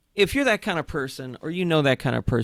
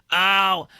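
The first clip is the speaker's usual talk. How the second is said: mock cheesy drawn-out "oh"; put-on goofy drive-thru voice; cheesy obnoxious voice; 5 semitones higher, 3 dB louder than usual. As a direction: imitate an obnoxious customer, pitched up. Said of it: "this cheesy voice, this obnoxious voice".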